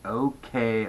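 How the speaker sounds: soft, deflated agreement; reluctant giving in. A narrator's reading. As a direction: give in softly. Girl gives in reluctantly.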